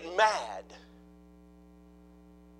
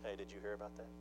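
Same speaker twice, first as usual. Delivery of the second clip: hushed gossip; 8 semitones lower, 18 dB quieter than usual. Acted. Mock gossip in the pews.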